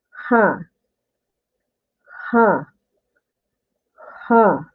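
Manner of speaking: "ha" said as a clear breathy Korean h plus a; Korean ha syllable. Shown as a demonstration. Teacher demonstrating Korean "ha" to viewers. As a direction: sound out "ha" slowly for students. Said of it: "then you will read as like".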